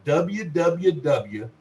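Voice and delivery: slow dictation. Shown as a demonstration. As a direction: spell each W out slowly and clearly. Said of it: "you spell out the whole name".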